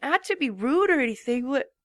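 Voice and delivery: tired, slowing questions; sleepy and worn out; drowsy. A narrator's reading. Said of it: "with a slight drowsiness".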